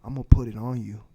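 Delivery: low, soft murmur in her ear; whispered dirty talk. Pretend. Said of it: "go in her ear and you tell her".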